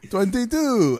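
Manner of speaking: high imitation of a counting vampire; playful high vampire number; mock vampire count. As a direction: join in as a cartoon vampire. Listener joins the vampire counting.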